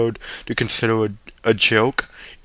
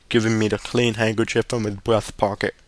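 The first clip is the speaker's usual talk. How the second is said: usual speaking voice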